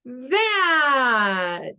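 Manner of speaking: high, loud, long falling word; exaggerated yell. Shown as a demonstration. Pointing out "that" for a child, loud and drawn out. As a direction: send it out big and let it fall slowly. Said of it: "and then I'll point at it finally".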